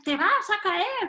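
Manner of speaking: high-pitched alarmist cry; hammed-up prophecy of disaster; dramatic warning. Teacher mocks a parent's warning, dramatic. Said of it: "¡qué drama!".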